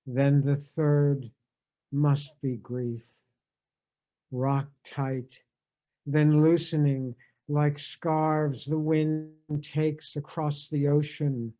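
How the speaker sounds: slow, measured reading with long pauses; poem reading. Acted. Reading a poem again to bookend the tribute, measured poem reading. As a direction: savour each line of the poem.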